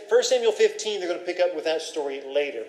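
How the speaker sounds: ordinary delivery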